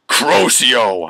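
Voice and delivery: loud, theatrical incantation; shouted spell. Acted. Student casting a curse at teachers, shouted. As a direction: bellow it like a curse. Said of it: "I shouted".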